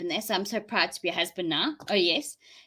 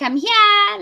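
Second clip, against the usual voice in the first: high, loud announcing arrival; loud call; 12 semitones higher, 10 dB louder than usual. Retold or imitated. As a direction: call out that you have arrived.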